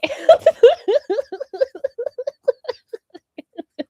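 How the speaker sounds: big laugh burst; loud high laugh. Real, not acted. Streamer laughing at their own singing, loud laugh. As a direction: crack up loudly.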